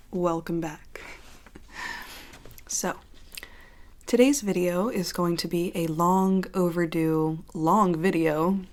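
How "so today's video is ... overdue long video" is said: ordinary talk, nothing marked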